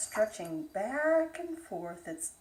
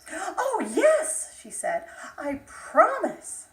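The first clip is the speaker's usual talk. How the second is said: high, louder eager agreement; eager yes; 7 semitones higher, 7 dB louder, slower than usual. Acted. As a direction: jump at the offer.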